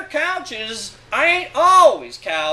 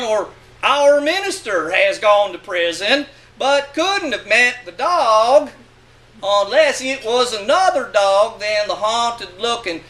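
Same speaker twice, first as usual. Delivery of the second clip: usual speaking voice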